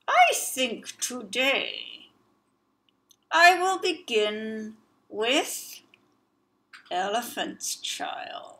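slow, menacing words through clenched teeth; through gritted teeth. Acted. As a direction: grit your teeth and savor it. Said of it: "speaking through his teeth".

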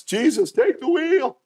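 high, slow pleading cry; desperate cry. Retold or imitated. Sinner in a skid crying out for rescue, high pleading. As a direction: cry out to Jesus in a panic.

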